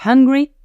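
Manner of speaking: "hungry" with a clearly breathed H; careful demo of H in "hungry"; sounded H. Shown as a demonstration. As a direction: puff out the first sound of "hungry". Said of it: "ça va faire toute la différence".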